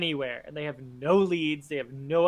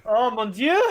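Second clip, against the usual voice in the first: sudden startled cry, higher and louder; shocked gasp; 6 semitones higher, 7 dB louder than usual. Real, not acted. Player reacting to the scene, shocked outcry. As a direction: blurt it out in shock.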